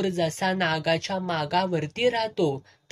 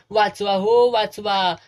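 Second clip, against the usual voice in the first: loud, higher cry for help; 3 semitones higher, 6 dB louder than usual. A narrator's reading. Anji crying out to the village, loud and higher. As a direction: shout for rescue, peaking on हो. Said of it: "आंजीनं आवाज वाढवला".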